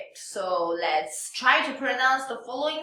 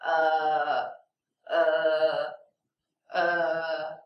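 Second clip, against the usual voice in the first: "uh" held low and level; lowest pitch; 7 semitones lower, much slower than usual. Shown as a demonstration. Teacher showing how to find the lowest point, low held "uh". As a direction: sink "uh" to the bottom of the voice. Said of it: "is the lowest point of your voice".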